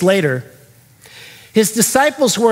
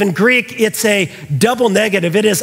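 ordinary talk, nothing marked

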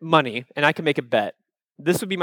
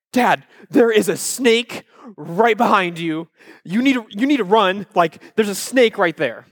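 higher-pitched, imitated alarm of a small child; mock panicked warning; 4 semitones higher than usual. Five-year-old warns dad about a snake, mock panic. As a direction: act out a frightened kid's warning without really screaming. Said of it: "I scream like a little girl".

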